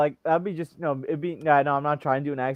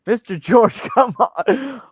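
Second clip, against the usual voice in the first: put-on high, loud kid's protest; imitated pleading pupil; 9 semitones higher, 7 dB louder, slower than usual. Pupil scolding teacher over his stream, high loud mock protest. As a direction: whine loudly at teacher like a kid.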